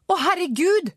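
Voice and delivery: high cry of shock; shocked shout. A narrator's reading. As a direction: call it out from some way off. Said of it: "ropte Angela Falk et stykke unna".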